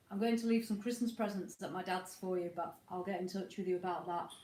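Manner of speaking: normal talking voice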